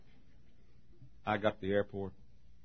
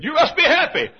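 high, loud mimicked stewardess with laughter; exclaiming stewardess; 15 dB louder than usual. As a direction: imitate her exclamation through a laugh. Acts stewardess while he laughs.

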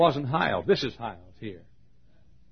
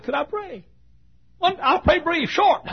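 pleading; 3 dB louder than usual. Retold or imitated. Pastor pleads at the door.

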